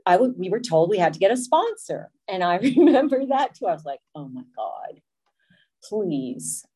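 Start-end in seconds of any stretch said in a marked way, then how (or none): 4.1-6.6 low, soft acted-out plea; quietly retold exasperation; exasperated dread. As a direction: groan it under your breath, lower. Dreads having to get a sponsor.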